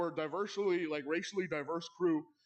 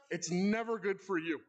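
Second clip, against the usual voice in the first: ordinary talk, nothing marked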